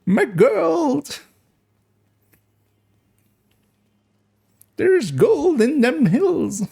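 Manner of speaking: put-on high, slow wheezy old timer; cackly gold rush miner; old prospector voice. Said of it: "we went with the old prospector voice".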